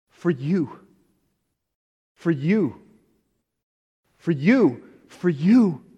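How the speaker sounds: YOU swooping high, then falling; emphatic repeated appeal. Real, not acted. Preacher telling each listener whom the sacrifice was for, emphatic appeal on YOU.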